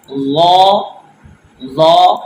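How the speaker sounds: slow teaching demo of لو; letter sounds. Shown as a demonstration. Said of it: "اسی طرح یہ".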